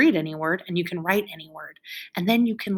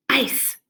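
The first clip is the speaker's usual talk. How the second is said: "Ice" as one high, firm beat; stomped syllable; 6 semitones higher than usual. Shown as a demonstration. Teacher stomps the word, "Ice" as one high beat. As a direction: stomp "Ice" out in a single hit. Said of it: "Stomp it".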